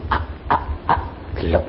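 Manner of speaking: acted voiceless gasps, then fading low; tight last breaths. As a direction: imitate three short struggling breaths before blacking out. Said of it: "Cuma ambekane sesek tok ping telu".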